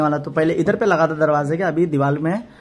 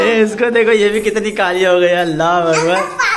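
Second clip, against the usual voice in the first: excited greeting; 7 semitones higher, 6 dB louder, slower than usual. Real, not acted. (बच्चों से मिलने की उत्साहित आवाज़)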